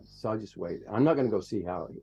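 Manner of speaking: ordinary delivery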